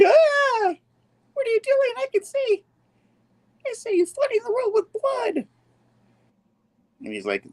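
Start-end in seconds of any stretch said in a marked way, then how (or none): 0.0-5.5 very high mock-terrified wail; acted panic. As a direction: wail it like a horrified comic-book heroine. Acts out MJ's frightened vision.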